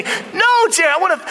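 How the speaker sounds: high-pitched acted protest; insistent client. Acted client pushes agent harder.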